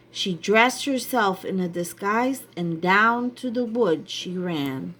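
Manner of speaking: ordinary delivery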